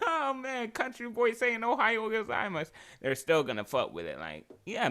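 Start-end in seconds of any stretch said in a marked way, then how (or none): 0.1-2.7 high-pitched teasing imitation of amused locals; making fun. Imagined locals laughing at a foreigner's Japanese, making fun. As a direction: tease in a much higher voice. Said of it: "they might make fun of them, be like".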